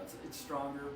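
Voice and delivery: ordinary delivery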